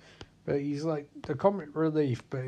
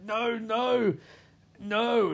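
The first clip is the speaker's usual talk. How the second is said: high, drawn-out pleading; hammed-up high wail of refusal; mock horror; 7 semitones higher, much slower than usual. Fishburne forced to watch horror, high mock protest.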